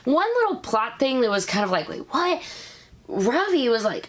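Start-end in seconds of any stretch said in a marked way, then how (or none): 1.9-2.4 sudden high mock double-take; confused wait what. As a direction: pitch it up high in disbelief. Confused by a plot point.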